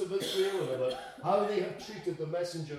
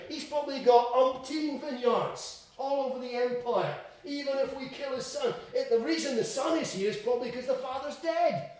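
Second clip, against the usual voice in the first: higher-pitched, animated reasoning; acted rationalizing, pitched up; self-justifying tenants; 7 semitones higher than usual. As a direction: talk yourself into it as a scheming tenant.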